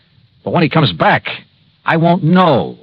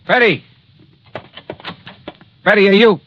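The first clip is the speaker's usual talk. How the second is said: raised, higher call into empty rooms; 10 semitones higher than usual. Acted. Johnny calls for Betty through the apartment, anxious shout. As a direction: call her name, afraid of no answer.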